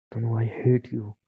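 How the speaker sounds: very slow, every word weighed; slow cold hatred. Acted. Husband telling his wife he hates her, slow and cold. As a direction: let it land word by word.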